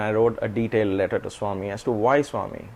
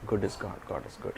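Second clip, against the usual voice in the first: much lower and softer, fading muttered refrain; muttering; 4 semitones lower, 12 dB quieter than usual. Retold or imitated. Swami walking away from the sink, muttering softly to himself. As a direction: mumble it to yourself as you walk away. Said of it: "He kept on muttering".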